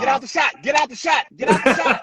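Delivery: high, loud repeated yell; shouted set command; crew shout. Retold or imitated. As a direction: bark it like an assistant director clearing set.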